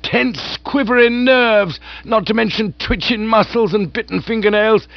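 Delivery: hearty aunt voice. Acted. Aunt gushing to Bertie about lunch. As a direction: raise the pitch and relish the food.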